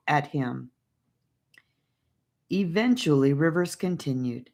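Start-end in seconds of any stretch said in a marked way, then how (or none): none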